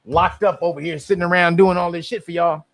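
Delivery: normal talking voice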